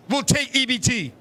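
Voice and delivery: normal talking voice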